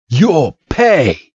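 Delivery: mildly hammed-up anger; acted threat. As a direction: threaten with mock fury. Red vows revenge.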